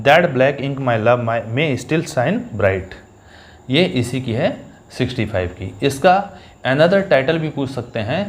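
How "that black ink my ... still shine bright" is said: reading the verse very slowly; slow, careful recitation; slow recited line. Shown as a demonstration. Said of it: "कि ये line किसकी है". (धीमे से पंक्ति का पाठ)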